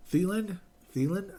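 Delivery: high incredulous question; can't believe it. Real, not acted. Incredulous about signing.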